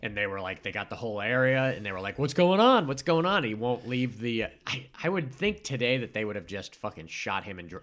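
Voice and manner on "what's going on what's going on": raised, higher mock-urgent questioning; imitating urgent demand. Police arriving at the scene, imitated urgent demand. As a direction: mimic cops calling out on arrival.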